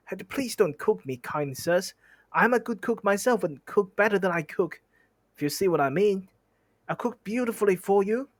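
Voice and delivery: high, begging plea; pleading hobbit. Acted. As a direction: beg the trolls for your life. Bilbo begging the trolls.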